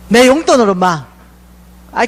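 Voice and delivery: usual speaking voice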